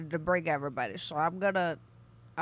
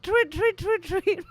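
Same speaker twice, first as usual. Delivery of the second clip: high, loud chirped tweets; birdsong imitation; 13 semitones higher, 3 dB louder, slower than usual. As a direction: chirp like a small bird. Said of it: "I just heard tweet".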